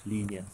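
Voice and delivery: "linear" set apart after a pause, falling; stress on linear. Shown as a demonstration. Teacher stresses key term to students, "linear" emphasized. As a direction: lean on the word "linear" alone. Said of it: "I again want to stress this word".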